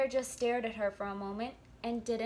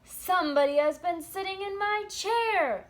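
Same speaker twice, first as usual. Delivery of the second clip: big bear voice, higher and much louder; great gruff bear; 8 semitones higher, 8 dB louder than usual. Acted. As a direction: boom it out as papa bear. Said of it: "great, big, gruff voice".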